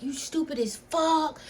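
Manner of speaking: mock his insult, dragging it out; imitating her boyfriend's angry insult; imitating him. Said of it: "He like".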